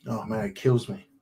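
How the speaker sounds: soft, low, pained aside; real heartache, low and subdued; heartbroken. Real, not acted. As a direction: say it quietly, as if it really hurts. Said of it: "it breaks my heart".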